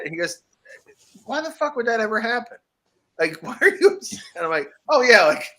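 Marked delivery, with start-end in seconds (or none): 1.2-4.2 higher, baffled father's voice, laughing at the end; incredulous dad. Retold or imitated. Dad scoffing at his son who couldn't sleep, incredulous. 4.9-5.4 put-on high, loud "oh, yeah" of a child catching on; the kid suddenly getting it; sudden realization. Boy answering his dad at bedtime, realizing.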